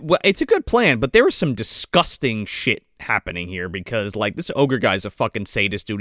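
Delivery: usual speaking voice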